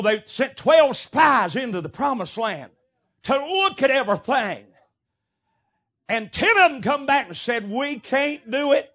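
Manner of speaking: loud, high preacher's cry; fiery preaching. Acted. Preacher rousing his congregation, loud fiery preaching. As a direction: preach it out to the pews.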